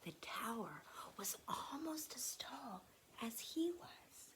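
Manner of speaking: full whisper, hushed and careful; whispered narration. A narrator's reading. Narrator whispering as Anne stacks blocks, hushed whisper. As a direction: whisper it like a secret being built. Quiet hushed story moment.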